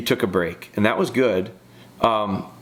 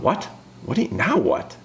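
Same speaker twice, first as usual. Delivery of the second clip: higher-pitched, baffled questions; confused dog; 5 semitones higher than usual. Acted. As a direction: voice the dog's bewilderment in quick questions. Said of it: "The dog is confused as all get up".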